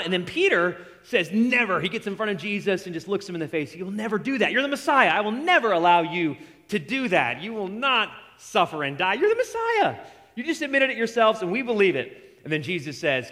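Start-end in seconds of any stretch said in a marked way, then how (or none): 1.3-12.2 insistent protest. Retold or imitated. Peter blocking Jesus from suffering, high and insistent. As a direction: refuse it with rising passion.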